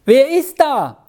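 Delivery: high-pitched, startled challenge; high, urgent cry of who's there; loud call. Acted. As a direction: call out sharply into the dark. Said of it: "rief Käthi so laut".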